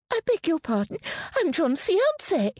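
very high, squeaky outrage; indignant squeak. A narrator's reading. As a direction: squeak it out, thoroughly affronted. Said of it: "Amelia's voice squeaked with indignation".

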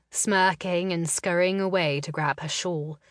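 ordinary delivery

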